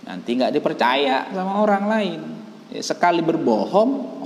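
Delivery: normal talking voice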